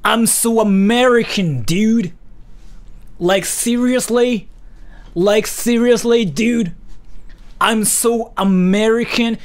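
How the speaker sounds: American bro voice. Acted. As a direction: play the clueless American bro. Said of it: "that's what I think Americans sound like".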